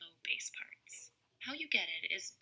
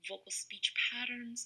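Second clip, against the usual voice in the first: normal talking voice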